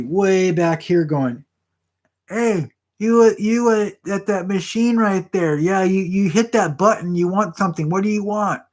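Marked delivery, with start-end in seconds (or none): 2.3-8.6 higher-pitched mock call to a far-off gambler; calling across the room. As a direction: hail someone at a distant machine. Waiter calling across the casino.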